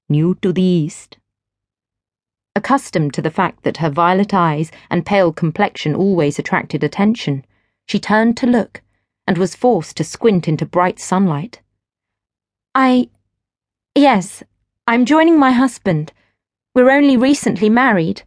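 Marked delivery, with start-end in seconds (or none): 0.1-1.2 honeyed male voice. A narrator's reading. Stranger on the ship greets Gwen, lightly honeyed. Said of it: "with an unusually honeyed tone". 12.7-18.2 higher, a little louder, nervous; flustered young wife. A narrator's reading. Gwen answers the stranger, a touch flustered.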